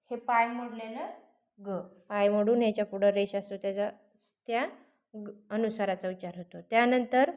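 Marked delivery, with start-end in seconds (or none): none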